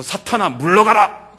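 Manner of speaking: loud, slow commanding rebuke; slow, loud order for Satan to get back; Jesus loudly and slowly ordering Satan away; stern rebuke. Acted. Jesus rebukes Satan through Peter.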